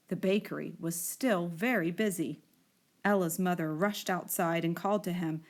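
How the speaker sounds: usual speaking voice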